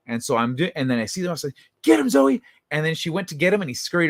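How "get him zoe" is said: high loud command to the cat; urgent call. Retold or imitated. Urging cat to catch mouse.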